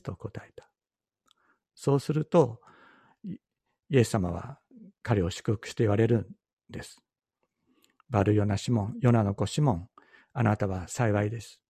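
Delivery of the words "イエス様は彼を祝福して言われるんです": ordinary delivery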